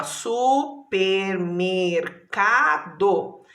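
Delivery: Supermercado drawn out very slowly so each syllable is heard; slow, stretched word. Shown as a demonstration. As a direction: show the long word slowly to the class. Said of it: "olha a palavra enorme".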